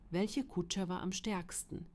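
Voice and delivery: ordinary delivery, nothing marked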